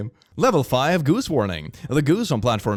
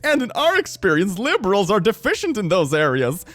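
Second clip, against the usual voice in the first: laughing; 8 semitones higher than usual. Real, not acted. Laughing while reading mocking sign.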